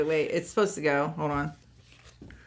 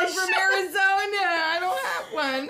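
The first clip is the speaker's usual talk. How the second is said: laughing mimicry; 8 semitones higher than usual. Mocking friend's protest.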